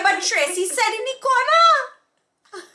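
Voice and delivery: high, rushed mock protest; mock argument. Co-host teasing her friend over bad advice, high mock protest. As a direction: act out the protest, voice climbing high.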